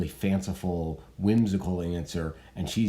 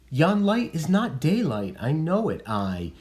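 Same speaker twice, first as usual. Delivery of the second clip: reading Juliet; 8 semitones higher than usual. Acted. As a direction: insist it is still night, high. Teacher reads Juliet's next lines.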